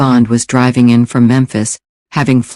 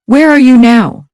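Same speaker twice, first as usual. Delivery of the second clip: much higher, louder female voice; Becky picking up Gilhooly's call, higher and louder; Becky's voice; 12 semitones higher, 5 dB louder than usual. Acted. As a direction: lift into a bright woman's voice on the phone.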